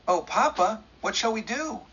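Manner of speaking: high, frightened plea; begging her father for help; pleading in fear. A narrator's reading. Daughter pleads in panic.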